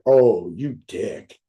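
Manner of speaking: low, slow, grumbled "oh, you dick"; grudging curse. Retold or imitated. Speaker replays cursing his friend for guilting him, low and slow. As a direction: drag it out low like you've been cornered. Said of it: "I was like".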